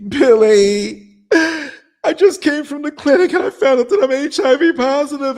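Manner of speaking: high, loud, tearful mock wail; crying, high voice. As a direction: wail it out like he's falling apart.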